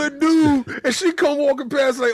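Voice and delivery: ordinary talk, nothing marked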